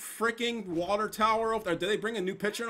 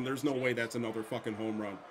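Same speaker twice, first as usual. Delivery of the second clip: ordinary talk, nothing marked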